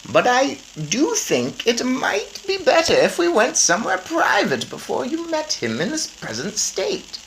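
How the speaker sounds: put-on an old lady explaining calmly; grandmother's voice. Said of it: "my grandmother said".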